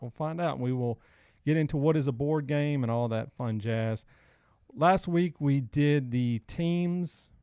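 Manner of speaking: ordinary delivery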